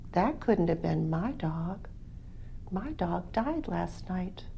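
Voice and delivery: Joey softly explaining his dog died; quiet sad reply. Acted.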